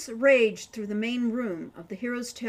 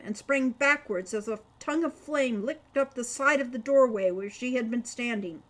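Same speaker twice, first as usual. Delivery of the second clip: plain reading voice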